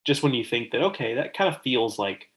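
ordinary talk, nothing marked

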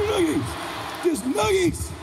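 high-pitched mock battle cry; frantic fighting kid. Boy noogieing rival in a headlock, frantic. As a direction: yelp it mid-scuffle, high.

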